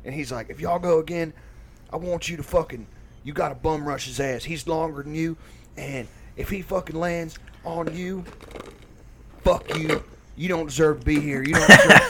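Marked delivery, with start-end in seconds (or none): 0.5-10.0 higher, mock heated coaching; angry dad impression. Dad telling son to rush him, mock aggressive. 10.4-12.0 mock tirade leaping into a high yell; escalating dad rant. Dad berating his opponent to son, mock outrage building.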